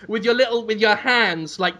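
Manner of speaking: normal talking voice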